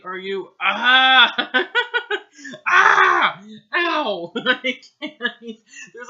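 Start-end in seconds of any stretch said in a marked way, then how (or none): 0.0-1.7 sudden loud mock cry of pain, slow; fake scream. Reader acting a sudden pain cry. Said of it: "a period after ow". 2.7-4.3 higher, louder hammed-up yell of pain; mock scream and ow. Reader hamming the boy's pain cry. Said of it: "like, a period after ow".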